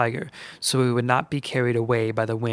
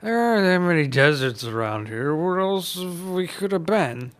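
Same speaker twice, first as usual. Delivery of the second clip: exasperated Uncle Seamus; 5 semitones higher than usual. A narrator's reading. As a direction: argue with the witch.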